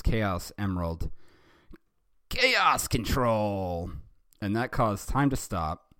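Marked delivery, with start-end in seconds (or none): 2.3-3.9 hammed-up power-move call; drawn-out incantation. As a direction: declare it slowly, raised and stretched out.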